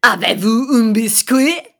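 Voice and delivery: mimicking a French learning CD in a terrible accent; heavy French accent. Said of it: "this really heavy, terrible accent".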